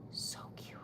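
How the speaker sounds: real hushed, tiny whisper; gushing whisper. Real, not acted. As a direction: whisper it, melting over something adorable. Gushing over the bear stamp.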